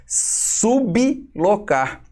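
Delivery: "sublocar" slowly broken apart as SUB, LO-CAR, with B apart from L; syllable split. Shown as a demonstration. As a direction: stretch "sublocar", keeping the B out of the L's syllable. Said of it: "Ele não é bloco, ele é". ("sublocar" bem devagar, separando o B do L: sub-lo-car)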